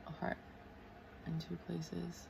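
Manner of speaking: very soft, slow self-dictation; hushed note to self; murmuring while writing. Real, not acted. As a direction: mouth it slowly to yourself. Murmurs words while writing them down.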